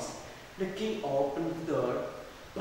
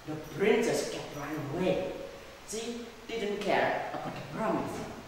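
plain reading voice

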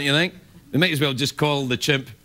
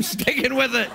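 ordinary talk

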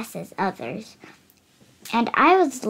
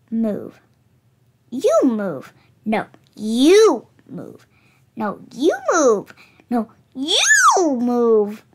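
cookies bickering, louder and louder; squabbling cookies; 5 dB louder, slower than usual. Acted. Cramped cookies fighting for room, bickering loud. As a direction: shove each other aside, shouting.

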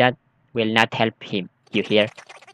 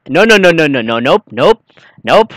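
louder rapid nos of alarm; nervous refusal; 2 semitones higher, 6 dB louder than usual. Real, not acted. Player spotting something under the bed, alarmed. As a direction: back away in a hurry.

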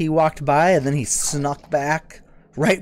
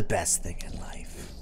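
hushed whispered aside; whispering; 7 semitones lower, 8 dB quieter, slower than usual. Real, not acted. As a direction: whisper it while hiding. Whispered aside while hiding.

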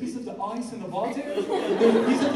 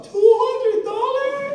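high, loud, slow astonished cry; shocked at price; 13 semitones higher, 9 dB louder, much slower than usual. Retold or imitated. Dad echoes son's watch price in shock. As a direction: repeat the price in disbelief.

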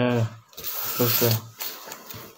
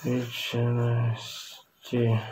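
writing while speaking; 2 semitones lower, 9 dB quieter, much slower than usual. Real, not acted. Writing down dictated words.

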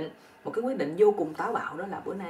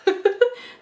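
high burst of laughter; 13 semitones higher, 8 dB louder than usual. Real, not acted. Storyteller amused by the old man's yell, high laugh.

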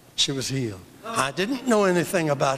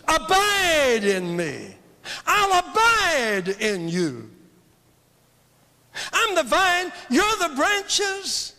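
slow scripture proclamation; 10 semitones higher, slower than usual. Real, not acted.

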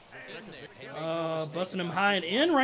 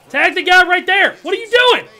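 much higher and louder, urgent; frantic shout; 8 semitones higher, 12 dB louder than usual. Real, not acted. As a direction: yell the order, then explode. Yelling at fielder to tag.

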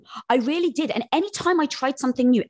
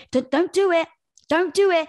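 protective ego; 8 semitones higher than usual. Retold or imitated. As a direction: plead urgently to stop her. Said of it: "it's trying to protect you to say".

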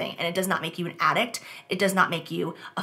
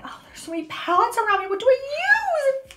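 mock overwhelm. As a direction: stretch it high like a dazed little voice. Overwhelmed by makeup palettes.

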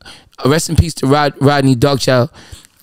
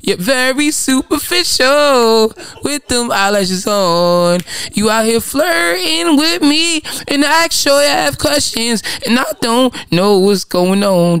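much higher, slow camp flirting voice; flirtatious mock voice; 9 semitones higher, slower than usual. Host teasing co-host about her eyelashes, high slow flirty voice. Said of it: "it's a flirtatious tone".

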